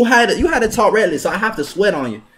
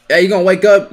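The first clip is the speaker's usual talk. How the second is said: ordinary delivery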